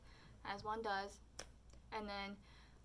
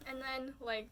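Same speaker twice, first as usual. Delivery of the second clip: ordinary talk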